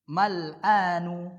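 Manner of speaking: careful teacher demo of mal'anu; slow model word. Shown as a demonstration. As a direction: stretch mal'anu for the class to repeat. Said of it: "Ad-dalwu".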